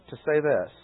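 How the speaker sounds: ordinary delivery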